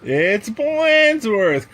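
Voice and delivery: crying out. Acted. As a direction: call it out as kids unmasking him. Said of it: "cried the kids".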